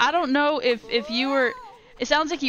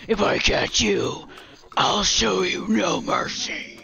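slow, menacing villain voice; villain threatening a chase; evil voice. Acted. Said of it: "like, that evil voice".

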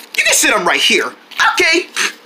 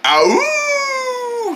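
pained ow; 10 semitones higher than usual. Real, not acted. Hurt while chasing.

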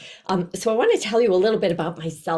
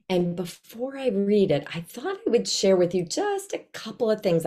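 normal talking voice

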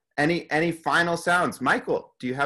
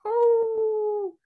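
soft wolf howl. Shown as a demonstration. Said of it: "let's try the soft one".

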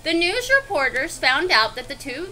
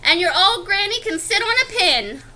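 higher, mildly yelled insult; yelled taunt; 5 semitones higher, 4 dB louder than usual. Acted. Third pig insulting wolf's granny, mock-yelled taunt. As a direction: taunt him from behind the door. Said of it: "the third little pig yelled".

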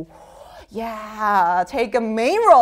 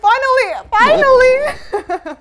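high, loud, drawn-out exclamation; mock outrage; 11 semitones higher, 6 dB louder, slower than usual. Real, not acted. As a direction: echo the word in playful disbelief. Reacts to a viewer's comment.

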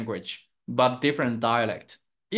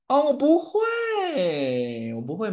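long, high, drawn-out whine; stretched-out tearful-sounding complaint; mock wail; 10 semitones higher, 4 dB louder, slower than usual. Real, not acted. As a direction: wail it in playful despair. Mock wail at not knowing.

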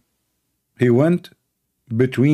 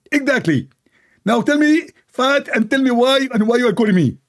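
high-pitched, irritated put-on voice; mock-annoyed, high telling-off; Allah; 11 semitones higher than usual. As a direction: scold the caller in a high comic voice. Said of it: "Allah, he answered".

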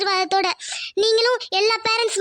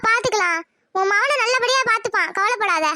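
ordinary talk, nothing marked

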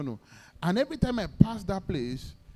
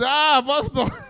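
high, loud mock cry of Ah, Pastor; retold excited greeting; delighted greeting; 9 semitones higher, 11 dB louder, much slower than usual. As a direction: hail the pastor in happy surprise. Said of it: "And I was".

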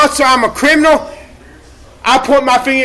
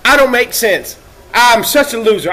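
usual speaking voice

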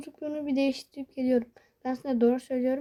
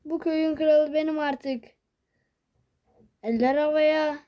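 higher, louder king-of-the-village cheer; triumphant boast; 4 semitones higher, 4 dB louder than usual. Real, not acted. Gamer hyping himself after a kill, triumphant. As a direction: crow about winning.

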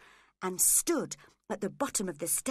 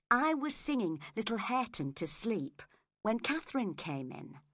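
ordinary delivery, nothing marked

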